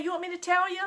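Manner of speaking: ordinary delivery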